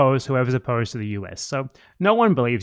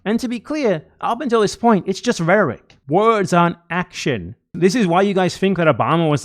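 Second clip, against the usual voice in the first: usual speaking voice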